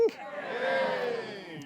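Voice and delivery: high airy mock cheer. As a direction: cheer for the crowd, breathy and high. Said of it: "You up for a bit of a sing?".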